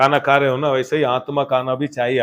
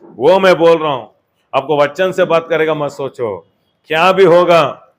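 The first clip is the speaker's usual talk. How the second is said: loud, raised preacher's declaration; forceful preaching; 4 semitones higher, 7 dB louder than usual. Real, not acted. Preacher assuring the congregation God will speak, forceful preaching. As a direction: proclaim it loudly, stretching the last words.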